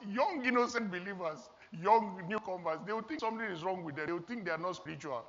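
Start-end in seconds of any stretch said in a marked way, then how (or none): none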